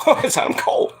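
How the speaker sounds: high whispered aside; unimpressed whisper. Retold or imitated. Vlogger unimpressed by a star, whispered aside. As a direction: shrug it off, whispered. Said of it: "I'm like".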